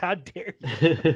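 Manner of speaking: very slow, drawn-out mock outrage; mock indignant glare. Activist staring down the president, slow mock outrage. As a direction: stretch it out like a scandalized scold. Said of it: "looks at Trump like".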